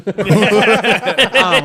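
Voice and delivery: loud laugh into the words; laughing at the plane tilting. Real, not acted.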